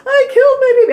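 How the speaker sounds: hammed-up high, loud wail; re-enacted hysterical cry; screaming. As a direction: scream it tearfully, edged with laughter. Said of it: "I don't know why I'm screaming".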